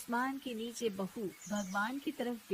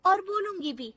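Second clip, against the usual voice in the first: defiant shout; 7 semitones higher, 7 dB louder than usual. Acted. Daughter-in-law talking back.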